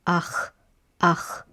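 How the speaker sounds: ach with throaty ch ending; ach blend. Shown as a demonstration. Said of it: "So you go ah, and then ch".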